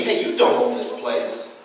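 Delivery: usual speaking voice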